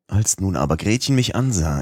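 ordinary delivery, nothing marked

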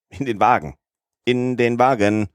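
calling out. A narrator's reading. Conductor orders passengers back into the train, calling out. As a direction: call the boarding order, stretching it the second time. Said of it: "rief der Kondukteur".

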